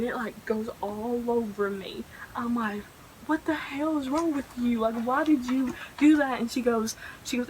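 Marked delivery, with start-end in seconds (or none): 3.3-6.4 high, louder indignant demand; mock outraged confrontation. Girl confronting friend who spilled drink on her, indignant. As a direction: re-enact scolding a prankster friend.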